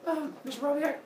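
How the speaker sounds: high, hesitant schoolkid voice; high-pitched student question; puzzled pupil. Acted. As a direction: pipe up to interrupt the teacher.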